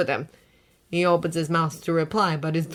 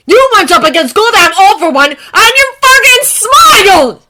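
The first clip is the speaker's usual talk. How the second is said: angry; 12 semitones higher, 16 dB louder than usual. A narrator's reading. Bakugo shouting.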